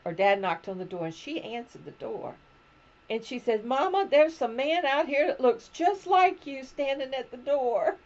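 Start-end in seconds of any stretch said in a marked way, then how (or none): 3.7-8.0 imitating a young Southern girl, higher and louder; mock Southern child calling her mother; southern accent. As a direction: call out to Mama in a Southern drawl. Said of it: "in her southern accent".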